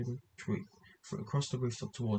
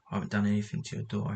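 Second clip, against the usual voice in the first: ordinary delivery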